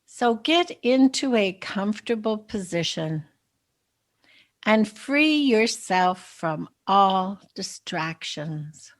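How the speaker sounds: unhurried meditation guide, softening at phrase ends; guided meditation. Acted. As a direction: lead listeners gently into relaxation, with long pauses. Leads a guided visualization.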